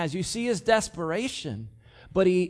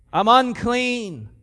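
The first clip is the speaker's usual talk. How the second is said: slow, louder mock yell of I'm unclean; 5 semitones higher, 7 dB louder, slower than usual. Leper warning townspeople away, slow raised call. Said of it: "I have to yell".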